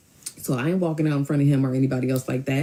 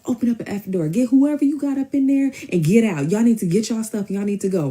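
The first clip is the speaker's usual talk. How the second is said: high, insistent door-banging rant; retold angry demand; 8 semitones higher than usual. Cousin banging on the door to get them out, angrily demanding. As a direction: imitate her ordering everyone out, pitched up. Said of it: "she start banging".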